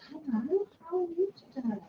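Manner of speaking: high, quick puppet greeting. Shown as a demonstration. Puppet greeting the children, high and quick. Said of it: "So we can say".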